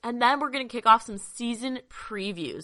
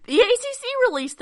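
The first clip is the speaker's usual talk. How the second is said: higher, louder excited announcement; burst of excitement; 7 dB louder than usual. Real, not acted. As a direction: blurt the news out loud. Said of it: "burst of excitement there".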